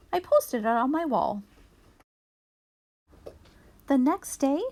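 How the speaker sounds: ordinary delivery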